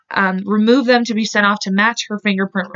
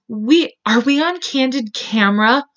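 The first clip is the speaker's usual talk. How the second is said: mocking girl voice; 4 semitones higher than usual.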